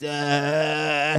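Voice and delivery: drawn-out droning vocal-fry "uh"; mock vocal fry. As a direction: hold one long creaky mocking "uh". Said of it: "people with vocal fries".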